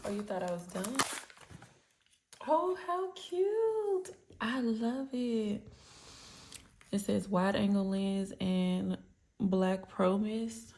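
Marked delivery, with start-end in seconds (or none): 2.5-5.6 long, high coo of delight, easing down. Real, not acted. Unboxer finding one more item, delighted cooing. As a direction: draw it out slowly, thrilled by the cuteness.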